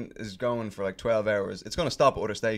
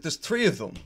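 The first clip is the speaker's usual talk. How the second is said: incredulous protest; 6 semitones higher, 4 dB louder than usual. Retold or imitated. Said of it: "And I was like".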